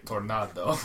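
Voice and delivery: "tornado" drawn out, hokey Spanish-style; odd Spanish pronunciation. Retold or imitated. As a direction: say "tornado" slowly as an exaggerated Spanish word. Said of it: "like, the odd pronunciation".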